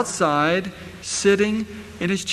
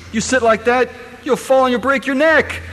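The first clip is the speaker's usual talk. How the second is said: scolding parent; 5 semitones higher, 5 dB louder than usual. Retold or imitated. Parent scolding a child leaning back in a chair, loud warning. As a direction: warn a child off tipping the chair. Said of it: "what do we tell them?".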